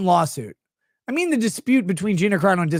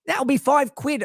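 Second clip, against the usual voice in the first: higher-pitched mock British streetwalker; British accent; 7 semitones higher than usual. Prostitute quotes her price to a client, British accent. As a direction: put on a British woman quoting her price. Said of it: "'cause she's British".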